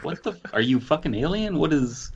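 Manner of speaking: ordinary talk, nothing marked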